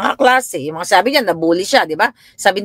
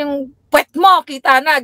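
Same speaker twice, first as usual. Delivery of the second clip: scolding; 8 semitones higher than usual. Retold or imitated. Mother scolding, retold.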